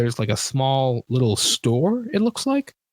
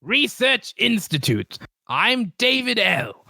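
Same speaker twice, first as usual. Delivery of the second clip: shopkeeper character voice; 7 semitones higher than usual. Acted. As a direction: put on a high, stretched-out greeter's voice. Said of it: "trying to find this guy's voice".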